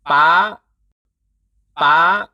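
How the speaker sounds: PA climbing to a high pitch. Shown as a demonstration. Teacher modelling Thai high tone, climbing high. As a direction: push "pa" up high and hold it. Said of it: "Three tone is higher than the falling tone".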